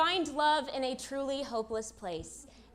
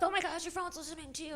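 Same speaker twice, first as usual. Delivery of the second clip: mock old person; 4 semitones higher, 6 dB quieter, faster than usual. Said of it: "old people are always like".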